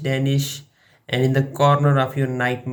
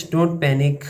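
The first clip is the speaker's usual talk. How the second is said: ordinary talk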